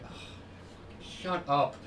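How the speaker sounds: slow, voiceless retort through a sigh; drawn-out, whispered exasperation; exasperated hush. Retold or imitated. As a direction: imitate shushing someone under your breath. Retold quarrel with drunk passenger.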